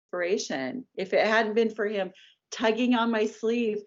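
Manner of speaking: ordinary talk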